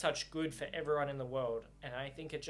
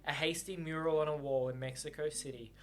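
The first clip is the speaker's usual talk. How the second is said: story narration; 3 semitones lower than usual. A narrator's reading. Reader narrating the novel to viewers, calm.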